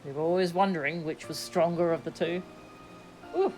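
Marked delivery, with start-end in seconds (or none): none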